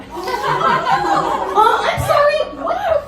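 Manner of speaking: high, loud mock shock; shocked disbelief. Overhearing the mom insult her kids, high stunned squeal. Stunned reaction to mom's burn.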